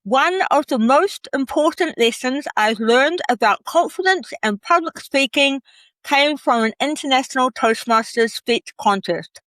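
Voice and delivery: reading with a pen held in the mouth. Shown as a demonstration. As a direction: talk around a pen between the lips. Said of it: "So I've got my pen in my mouth".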